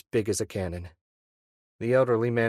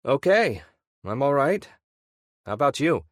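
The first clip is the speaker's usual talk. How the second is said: higher, louder, breezy front; forced casual Ian; 5 semitones higher, 5 dB louder than usual. Acted. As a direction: sound fine while anxious. Ian voiced on phone.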